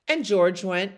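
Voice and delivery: ordinary delivery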